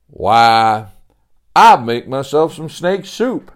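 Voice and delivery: a visitor greeting grandly at the door; folksy old-timer Sebastian. Acted. Sebastian voiced.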